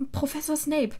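high-pitched startled exclamation; shocked. A narrator's reading. Hermine is caught by the teacher, shocked. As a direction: blurt out the name in shock. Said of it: "sagte sie geschockt".

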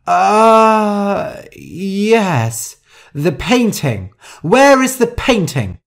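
loud high stalling sound into sharp demand; flustered demand. Acted. Rosa caught out, demanding the painting, flustered and loud. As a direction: falter loudly, then demand angrily.

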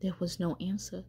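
usual speaking voice

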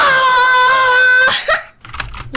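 loud high cackling laugh; shrieking laugh. Real, not acted. Streamer shrieks with laughter at the silly boat, loud laugh. Delighted at own creation.

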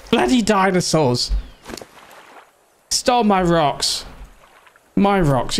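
high, slow indignant grumble. Real, not acted. Gamer robbed of rocks by dinosaurs, indignant.

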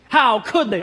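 mock outraged cry in capitals; all-caps outrage. Preacher reading an all-caps comment, mock outrage. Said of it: "All caps".

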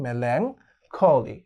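melancholy stretched very slowly; very slow drilled word. Shown as a demonstration. Teacher drilling the word for students, very slowly. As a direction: draw melancholy out syllable by syllable. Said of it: "দুটি ভাগে ভাগ করে নিতে পারো".

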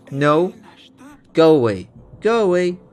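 loud dismissive shout; loud go away. Acted. King shoos off fortune teller.

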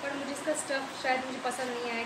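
ordinary talk, nothing marked

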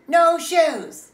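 very loud, high yell; defiant shout. Acted. Child takes shoes off in protest, shouting. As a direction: belt out both words in refusal.

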